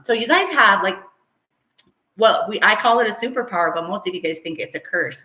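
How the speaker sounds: ordinary delivery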